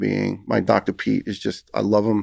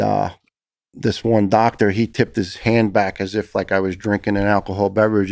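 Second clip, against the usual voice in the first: normal talking voice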